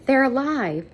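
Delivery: high, lifted with excitement; a touch of excited relief. Acted. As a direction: announce the good news with joy. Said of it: "the woman said excitedly".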